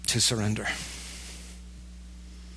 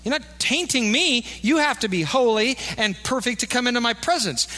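God's stern demand; 7 semitones higher than usual. Acted. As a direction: speak as a God laying down the law. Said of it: "And he says".

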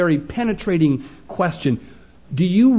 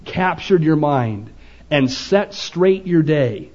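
ordinary delivery

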